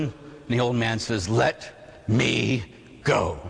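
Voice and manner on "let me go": word by word, dropping low at the end; halting refusal. Acted. Old man clinging to his dirt, halting refusal. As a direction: break it up with a heavy pause before the last word.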